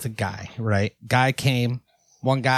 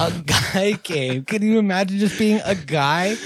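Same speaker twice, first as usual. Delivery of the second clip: mocking voice; 6 semitones higher than usual. Mocking the assembly guy.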